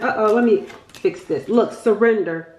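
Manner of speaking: ordinary talk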